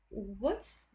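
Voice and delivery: ordinary delivery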